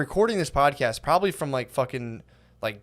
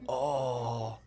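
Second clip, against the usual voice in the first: slow, rising stoner worry; mock a dazed stoner panicking slowly; stoned freak-out; 2 semitones lower, 9 dB quieter than usual. Stoner panicking over an unrecorded episode, mock stoned freak-out. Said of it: "a level ten stone freak out".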